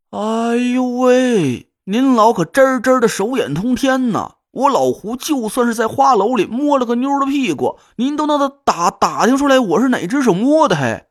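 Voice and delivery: put-on loud, amazed drunken exclaim; drunken astonishment. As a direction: stretch out the 哎呦喂 in loud shock. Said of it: "胡磊的语气很惊诧".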